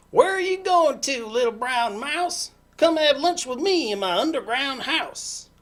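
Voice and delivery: loud, high fox voice; sly fox. Acted. Fox invites the little mouse to lunch, loud and high.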